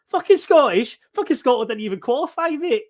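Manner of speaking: loud, high-pitched mock outrage; imitating scornful disbelief. Footballer jeering at a Scottish fan, mock-scornful disbelief. Acted scornful reply from Ian Rush.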